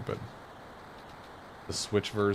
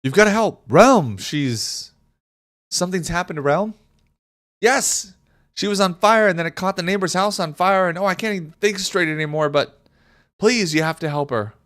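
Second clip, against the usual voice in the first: high, loud pleading cry for help; frantic villager; 9 semitones higher, 4 dB louder than usual. Acted. Villager begging the party to save Relm, frantic and high. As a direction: beg for help as if a child is burning. Reads panicked villager dialogue.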